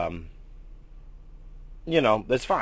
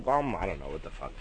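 ordinary delivery

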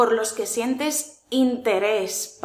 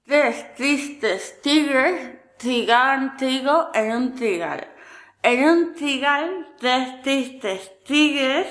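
"Tres tristes tigres trigaban trigo" said slowly and carefully, R sounds drawn out; tongue twister; slower than usual. Shown as a demonstration. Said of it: "practica con trabalenguas".

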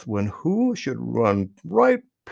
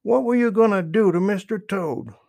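louder, higher challenge; demanding; 6 semitones higher, 5 dB louder than usual. A narrator's reading. Jimmy confronting Blacksnake over Toad, demanding. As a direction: demand an answer, pitched up. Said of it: "demanded Jimmy".

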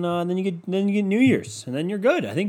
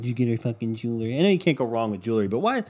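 normal talking voice

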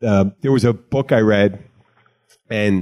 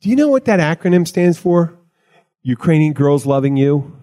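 retold pointed question; 6 semitones higher than usual. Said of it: "they go".

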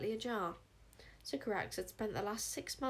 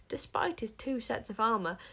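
ordinary delivery, nothing marked

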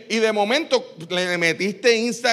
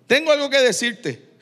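voz más aguda, cayendo al final; anuncio firme; 4 semitones higher than usual. Acted. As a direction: anunciarlo claro, en tono alto. Repite la frase de Jesús a Simón. (llamado firme en tono más agudo)

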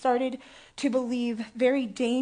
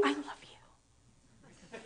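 slow breathy whisper; 16 dB quieter, much slower than usual. Pretend. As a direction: whisper it teasingly to the crowd. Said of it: "teasing the congregation".

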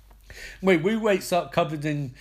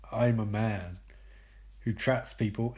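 low, hushed, deliberate boast; ominous tracker; 7 semitones lower, 6 dB quieter than usual. Acted. Hannibal says how he'll find him, low and ominous. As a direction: state it gravely, pitched well down.

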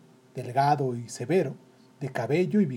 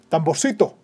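loud, brusque command; brusque captain; 2 semitones higher, 6 dB louder than usual. Acted. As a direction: bark the boy's name sharply. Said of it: "dijo con brusquedad".

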